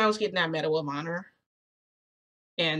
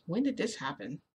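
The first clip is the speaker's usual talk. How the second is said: higher, softer bewildered aside; mild panic; 4 semitones higher, 5 dB quieter than usual. Acted. Narrator to herself, panicking a little bit. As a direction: panic quietly to yourself. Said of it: "I'm, like, panicking a little bit".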